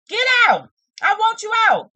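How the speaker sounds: high yelled get out; mock shout. Shown as a demonstration. Vlogger acting out the mixed message, high mock shout. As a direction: act out the angry half of her mixed message.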